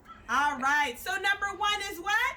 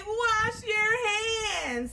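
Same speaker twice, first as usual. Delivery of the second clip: long, drawn-out shouted call, higher; called-out command; 5 semitones higher, much slower than usual. Shown as a demonstration. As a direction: belt it out like a coach calling a drill. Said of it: "I'm gonna say".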